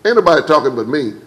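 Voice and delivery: normal talking voice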